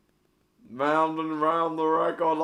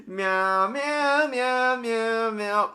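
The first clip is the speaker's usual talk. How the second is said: higher playful meowing; cat meows; 8 semitones higher, much slower than usual. Pretend. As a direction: meow like a silly cat. Cat noises.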